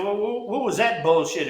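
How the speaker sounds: usual speaking voice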